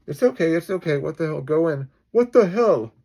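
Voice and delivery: high, loud alarm; panicked dialogue. Acted. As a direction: blurt it in rising panic. Acts people walking in on bodies.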